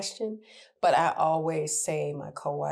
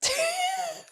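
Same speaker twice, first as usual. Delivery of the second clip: real high-pitched cackle; high shrieking laugh. Real, not acted. Laughing at her own story.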